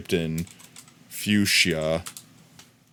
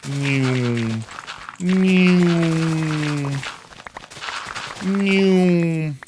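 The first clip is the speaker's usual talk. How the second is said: silly hum; 6 semitones higher, much slower than usual. Pretend. Playful vocal noise.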